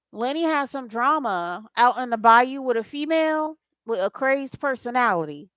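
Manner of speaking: ordinary delivery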